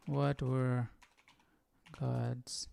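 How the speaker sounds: normal talking voice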